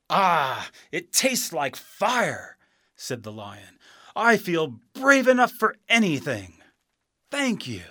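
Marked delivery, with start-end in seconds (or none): 0.1-2.6 loud, high gasp of sensation; startled exclamation. A narrator's reading. Lion swallows the fiery drink, exclaiming. 4.2-7.8 high, loud burst of confidence; bold and excited. A narrator's reading. Lion after drinking his courage, boldly excited.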